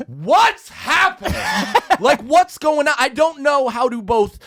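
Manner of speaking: very high comic squawk settling into a pleading rant; exasperated caller. Retold or imitated. Customer to a silent clerk about urgency, exasperated. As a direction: start in high-pitched mock disbelief, then ease down.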